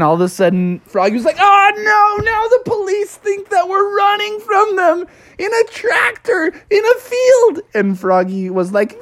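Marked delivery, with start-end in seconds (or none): 1.4-7.6 very high cartoon wail; hammed-up high-pitched panic; panicked frog. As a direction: wail the predicament in mock horror. Froggy panics over the police.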